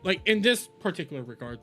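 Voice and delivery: normal talking voice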